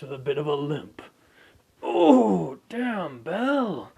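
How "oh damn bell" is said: impressed gasp. Acted. Reacting to the story.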